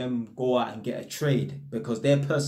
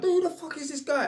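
much higher, imitated wary tenant; mock standoffish; 12 semitones higher than usual. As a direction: voice a suspicious tenant's thoughts, pitched way up. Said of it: "they're being really standoffish".

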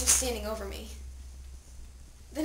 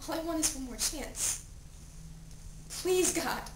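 soft plea sinking to near silence; fading plea; 2 semitones higher, 6 dB quieter than usual. Acted. Dead teen's last plea to God, soft and fading. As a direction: let the begging die away.

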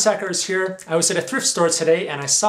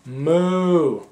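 cow moo. Pretend. Cow noises in a picture book.

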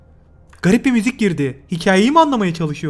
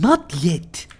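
imitating the game voice; 4 semitones lower than usual. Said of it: "not yet dedi".

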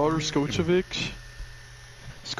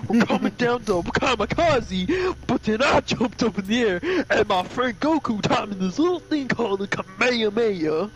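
hammed-up champion taking the credit; Mister Satan; 5 semitones higher than usual. Champion claiming credit for Pearl Harbor, hammed-up boasting. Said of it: "but he's like".

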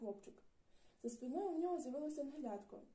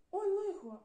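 high, loud startled exclamation; alarmed cry; 8 semitones higher, 9 dB louder, slower than usual. Acted. Boy crying out in alarm as the world whirls. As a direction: caught off guard as the world spins. World suddenly spins around.

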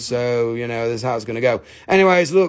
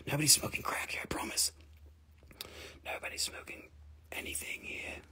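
hushed whisper; softly whispered reassurance; 18 dB quieter than usual. Real, not acted. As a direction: whisper it like a secret to the audience. Hushing talk about crack.

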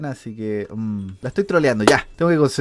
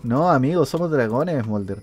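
ordinary talk, nothing marked

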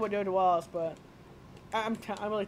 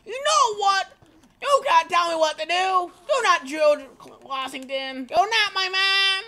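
high, loud protest; mock indignant outburst; 8 semitones higher, 7 dB louder than usual. Player arguing with the game, mock indignant shout. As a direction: argue back loudly.

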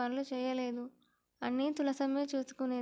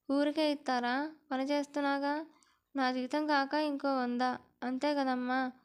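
usual speaking voice

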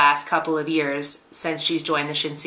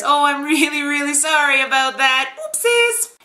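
high-pitched mock apology; cutesy apology rising to a squeak; Sanan; 7 semitones higher, 3 dB louder than usual. Reviewer voicing Sanan apologizing to Chizuru, high mock sorry. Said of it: "Sanan-san comes in and he says".

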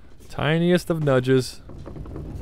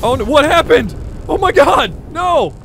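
panicked cry; 12 semitones higher, 6 dB louder, slower than usual. Real, not acted. Ship breaks apart suddenly.

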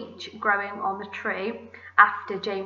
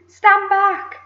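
very loud, high shout; shouted warning; 11 semitones higher, 10 dB louder than usual. A narrator's reading. Aunt warning others away from the branch, shouting. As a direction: shout a warning to get clear. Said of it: "Aunt Sponge shouted".

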